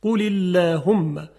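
قل اللهم slow and loud, with the lam light after the i; thin lam. Shown as a demonstration. Teacher models the correct thin lam. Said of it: "این خلاف فصاحته".

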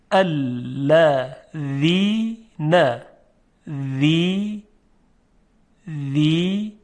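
الذین broken into slow syllables, then ذی held alone; syllable-by-syllable dhal. Shown as a demonstration. Teacher asking for attention, slow syllable-by-syllable demonstration. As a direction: sound out الذین slowly, isolating ذی with a voiced th. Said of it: "خب، دقت کنید".

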